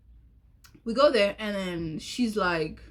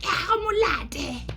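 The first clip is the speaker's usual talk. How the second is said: voicing her friend; 8 semitones higher than usual.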